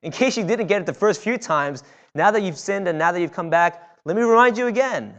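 hammed-up reminder ringing out over the congregation; mock exasperated reminder. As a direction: rub it in like a tired parent. Preacher mock-nags about the Sabbath.

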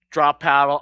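loud, cocky gloat after winning; mock trash talk. Preacher retells beating a kid at pickleball, loud cocky gloat.

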